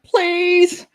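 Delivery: high-pitched pleading whine to parents; retold begging. Child begging parents not to send them to school, pleading. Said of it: "I just begged my parents".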